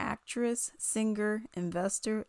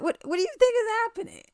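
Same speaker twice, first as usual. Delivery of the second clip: high, loud, rapid-fire question; exasperated disbelief; 14 semitones higher, 5 dB louder, much faster than usual. Retold or imitated. Challenging the crying singer's claims, exasperated disbelief. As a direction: stammer into a shrieky, incredulous rush.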